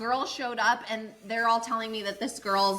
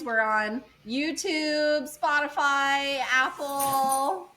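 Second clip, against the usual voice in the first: slow plug; 4 semitones higher, much slower than usual. Pretend. Plugging the podcast.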